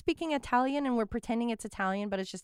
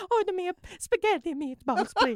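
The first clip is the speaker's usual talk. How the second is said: higher, louder hammed-up Italian accent begging; mock Italian plea; 7 semitones higher, 8 dB louder than usual. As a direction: beg in a cartoon Italian accent.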